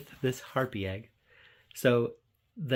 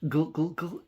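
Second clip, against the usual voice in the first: higher, punchy gulp gulp gulp; mock gulping; 7 semitones higher, 4 dB louder, slower than usual. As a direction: act out gulping a potion. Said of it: "my son's just like".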